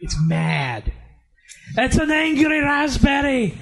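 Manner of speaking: loud, high, slow bad Irish accent, mock angry; hammed-up fake Irish brogue; shitty Irish accent. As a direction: shout it like an angry Irish raspberry mascot. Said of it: "in a really shitty Irish accent".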